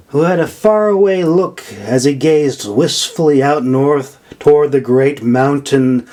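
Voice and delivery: plain reading voice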